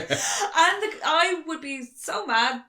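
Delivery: high, slow, laughing through the words; can barely get it out for laughing; real laughing speech. Real, not acted.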